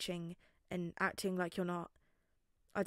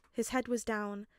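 ordinary delivery, nothing marked

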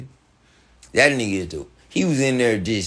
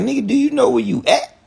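incredulous confrontation; 13 semitones higher, faster than usual. Retold or imitated. Retold confronting [name] in studio.

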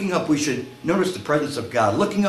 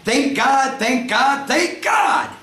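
high shout, building with each repeat; brave shout; 11 semitones higher, 3 dB louder, much slower than usual. Acted. Feeling really brave on the horse, bold rising shout. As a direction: shout it boldly, climbing to a peak. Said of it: "feeling really brave, the man says".

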